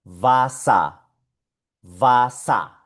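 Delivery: "vasa" with final ER as open A; German pronunciation demo. Shown as a demonstration. Teacher showing how it is pronounced, clear "va-sa". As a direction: model vasa in two clear syllables. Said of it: "se pronuncia".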